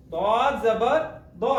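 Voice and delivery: दाद जबर दो spelled out slowly; syllable by syllable, दाद जबर दो; slow careful recitation. Shown as a demonstration. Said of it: "इसको पढ़ेंगे". (धीरे धीरे दाद जबर दो)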